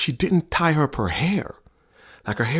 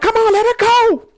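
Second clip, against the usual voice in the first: high, loud goading voice, much higher and louder; mock taunt; 8 dB louder than usual. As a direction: goad the linemen loudly and high. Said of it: "My wife is sitting there like".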